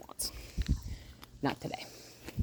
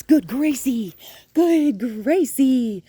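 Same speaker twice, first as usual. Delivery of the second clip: very high, louder slow praise; high drawn praise; 8 semitones higher, 8 dB louder, much slower than usual. Real, not acted. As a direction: gush with delight. Praising dog.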